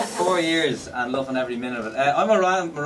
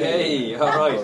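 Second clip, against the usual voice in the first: ordinary delivery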